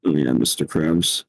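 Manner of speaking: low, slow creaky drawl; vocal fry. Pretend. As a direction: creak through it lazily. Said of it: "Are you feeling the vocal fry?".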